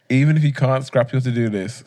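ordinary talk, nothing marked

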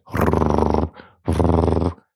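long rolled R as a drill; low, steady drilling rrrr; imitated drill noise. A narrator's reading. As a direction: trill the tongue like a power drill. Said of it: "zette de boor tegen de muur aan".

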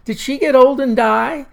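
put-on a worried little boy pressing; small boy asking. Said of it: "the young Jimmy Ralston".

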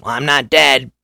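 high, loud, cheery Rick voice; Rick's answer. Retold or imitated. Rick answering the phone after waking, high and loud. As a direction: toss it off brightly, like nothing happened.

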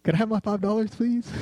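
high, sing-song polite request; overly polite plea for the five dollars; sweet polite asking. Retold or imitated. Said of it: "I was like".

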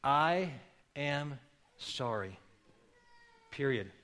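slow, soft and heartfelt; mean every word, quietly; sincere apology. Shown as a demonstration. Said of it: "you need to say them very sincerely".

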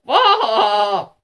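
loud, high menacing "aah"; long shouted vampire howl; vampire snarl cry. A narrator's reading. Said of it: "he snarled between his vampire teeth".

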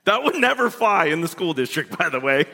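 usual speaking voice

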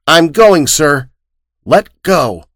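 put-on determined boy. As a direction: tear free and insist on going. Boy breaks free.

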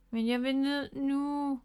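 high, slow, drawn-out whine; close to tears. A narrator's reading. Miffy wants down from the air, close to tears. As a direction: whine it slowly, near crying. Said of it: "var tæt på at græde".

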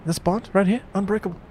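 high Antipodean badger voice; Australian or New Zealand accent. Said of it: "I want Australian and or New Zealand".

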